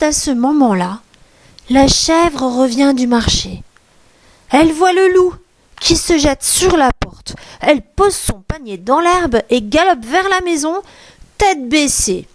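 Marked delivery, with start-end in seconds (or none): none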